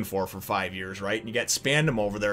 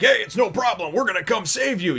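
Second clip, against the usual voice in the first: cheery rescuer; 9 semitones higher, 4 dB louder than usual. Retold or imitated. Voicing crew reassuring Robin.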